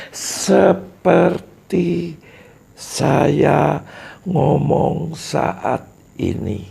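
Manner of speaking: very slow, word by word with pauses; slow rhythm demo. Shown as a demonstration. Teacher demonstrating slow speech rhythm to the audience. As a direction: stretch each word out slowly. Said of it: "Ini contoh, sudah contoh irama".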